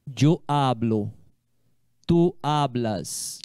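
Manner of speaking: slow, careful conjugation; deliberate teacher's slow model; slow demo. Shown as a demonstration. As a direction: spell it out slowly for the class.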